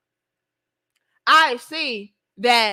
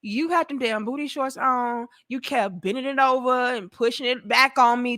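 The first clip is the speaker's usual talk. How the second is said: normal talking voice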